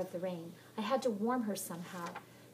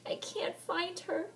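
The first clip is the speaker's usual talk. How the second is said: tearful cry; 11 semitones higher, slower than usual. Acted. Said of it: "she cried".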